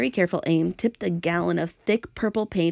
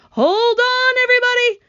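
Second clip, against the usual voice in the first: loud, very high shouted warning; calling out; 7 dB louder than usual. A narrator's reading. James warning everyone before the crash, calling out loud. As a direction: shout a warning to the whole group. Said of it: "James called out".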